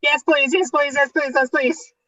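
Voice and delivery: high, breathless panicky yes please; panicked. Acted. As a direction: panic and gabble it out. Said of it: "It's just I'm panicking".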